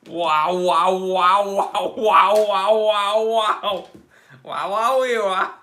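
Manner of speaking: loud rapid-fire string of wows; amazed wows. Real, not acted. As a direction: keep wowing loudly, over the top. Astonished at the watch's measurements.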